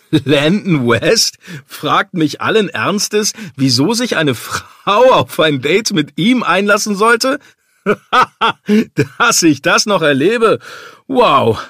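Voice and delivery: loud, high, gleeful disbelief through laughter; laughing hard. A narrator's reading. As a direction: crack up while marvelling at your friend. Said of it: "gluckste Damien und hielt sich den Bauch vor Lachen".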